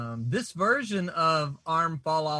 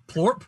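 a wet popping plorp, said louder; plorp sound; 4 semitones higher, 4 dB louder than usual. Shown as a demonstration. Describing the arm popping off, a wet plorp. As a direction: pop the word out like a limb coming off. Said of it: "Plorp is the sound".

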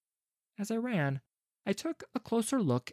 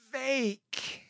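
croaking; 9 semitones higher, 4 dB quieter than usual. Acted. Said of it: "I croaked".

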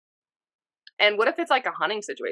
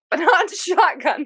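laughing; 11 semitones higher, 5 dB louder than usual. Real, not acted. Amused reply about shotgun.

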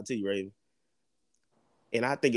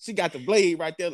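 ordinary talk

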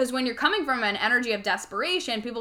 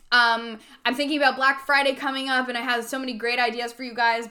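ordinary talk, nothing marked

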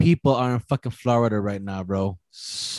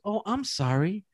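higher, slow, softer apology; apologetic drive-thru girl; 8 semitones higher, 5 dB quieter than usual. Retold or imitated. Man imitates drive-thru worker apologizing softly, higher and slow. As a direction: mimic her gentle, slow sorry. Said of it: "she goes".

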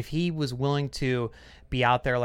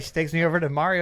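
ordinary talk

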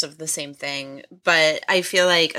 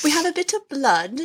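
bad British accent; 9 semitones higher than usual. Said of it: "Oh, I can't do a British accent".

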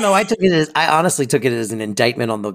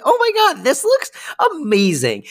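much higher, gushing delight; gushing girl; 12 semitones higher than usual. Retold or imitated. Gracie thrilled by the drama camp brochure, gushing high. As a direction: squeal it like a thrilled teen. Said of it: "she's like".

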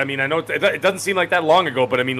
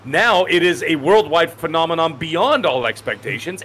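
ordinary talk

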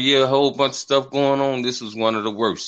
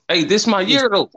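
excited; 10 semitones higher, 5 dB louder than usual. Real, not acted. Upbeat New Year boast.

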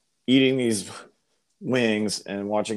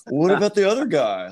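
high, louder eager fan; eager girl voice; 10 semitones higher, 5 dB louder than usual. Retold or imitated. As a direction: ask brightly like a smitten fan. Girls voiced, high and loud.

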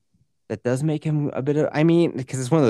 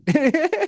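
high burst of laughter; 4 dB louder than usual. Real, not acted. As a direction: break into a laugh. Laughing at own joke.

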